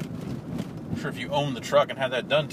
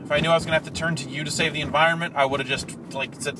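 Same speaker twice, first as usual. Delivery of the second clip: rant; 5 semitones higher, 4 dB louder, faster than usual. Real, not acted. Ranting at the Prius driver.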